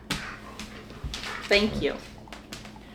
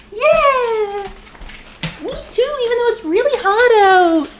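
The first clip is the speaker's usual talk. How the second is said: much higher and louder pet-talk squeal; squeaky dog voice; 14 semitones higher, 6 dB louder, slower than usual. Pretend. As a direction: answer for the dogs in an excited squeak. Voicing excitement for the dogs.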